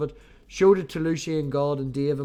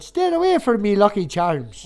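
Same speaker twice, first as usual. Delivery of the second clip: high, loud mock Irish leprechaun voice; a hammed-up Irish leprechaun snarl; mock Irish accent; 3 semitones higher, 6 dB louder than usual. Joking about his accent, a loud mock Irish. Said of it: "when she mentioned the accent".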